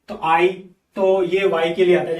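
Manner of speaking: ordinary talk, nothing marked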